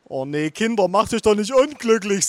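high, wailing plea; tearful grandma. A narrator's reading. Grandma pleading with the children, tearful. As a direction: plead as if close to tears. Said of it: "mit Tränen in den Augen".

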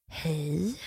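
low, soft tired greeting; weary, condescending. Retold or imitated. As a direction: imitate a fed-up doctor's quiet hello. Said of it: "de är lite trötta på mig".

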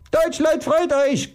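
very high strained rallying cry; Roy's outcry. Acted. Roy rallying the German people, crying out. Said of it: "keucht Roy".

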